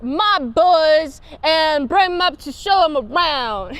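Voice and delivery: casual mobster order to the crew; Chicago mob accent tossed at Scotty. Retold or imitated. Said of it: "nineteen twenties lingo".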